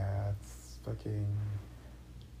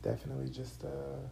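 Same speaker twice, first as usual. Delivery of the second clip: while sipping a drink; 7 semitones higher, 5 dB quieter than usual. Real, not acted. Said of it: "I'm drinking".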